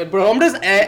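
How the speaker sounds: ordinary talk, nothing marked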